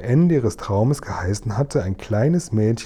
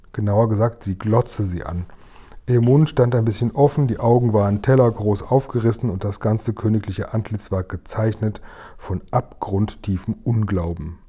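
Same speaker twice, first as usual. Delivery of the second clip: plain reading voice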